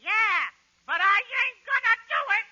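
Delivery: put-on high, loud kid voice; bratty little brother. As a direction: play a mouthy kid refusing at full volume. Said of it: "I'm gonna play both parts".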